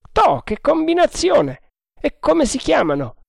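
the boatswain playing innocent; old sailor voice. A narrator's reading.

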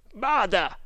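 high, quick alert; cried warning. A narrator's reading. One of the Tugs warning his leader, contained high cry. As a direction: call a warning, pitch up, volume contained. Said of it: "gridò uno dei Tugs".

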